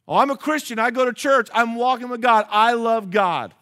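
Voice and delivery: high, loud, insistent protest. Acted. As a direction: imitate someone loudly vouching for their own faith.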